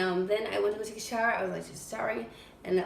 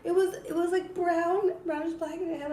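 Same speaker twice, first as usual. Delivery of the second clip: agitated; 8 semitones higher, 4 dB louder than usual. Real, not acted. Describing the scary spider.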